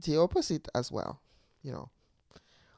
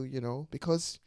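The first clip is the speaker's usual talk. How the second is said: ordinary talk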